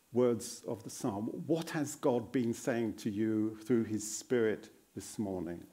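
normal talking voice

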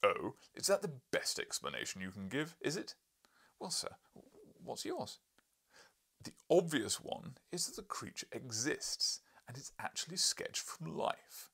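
loud, mocking challenge; scornful retort. Professor scoffing at the narrator's theory. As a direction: scoff at his answer, then insist.

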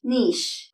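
"നീഷ്" as NEESH, one long syllable; correct pronunciation. Shown as a demonstration. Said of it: "അതിന്റെ ശരിയായ pronunciation". ("നീഷ്" ശരിയായി, "ഈ" നീട്ടി)

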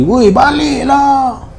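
high, very slow drawn-out call; retold shout. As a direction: stretch baliklah long across the field. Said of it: "Acap jerit lagi". (laungan tinggi yang sangat perlahan dan panjang)

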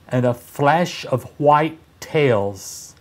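usual speaking voice

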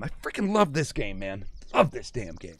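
low, hushed, heartfelt; fond murmur. Real, not acted. Streamer confessing love of the game, murmured.

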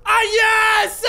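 high, loud yelp; sudden piercing outburst; startled shout. Real, not acted. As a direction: cry out as the scare hits. Jump scare hits the player.

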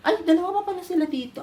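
sudden high "Ay" of surprise; surprised. Real, not acted. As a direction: discover them with a start. Surprised at finding two cats.

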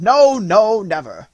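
emphatic high shout, easing at the end; loud cry. A narrator's reading. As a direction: cry out in loud, fervent denial. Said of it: "cried as loud as they could".

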